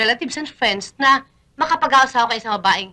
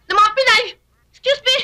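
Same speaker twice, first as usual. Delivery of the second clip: high, loud protest; indignant; 10 semitones higher, 4 dB louder than usual. Acted. Woman defends Filipino women to visitor, indignant. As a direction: protest with pitch shooting up.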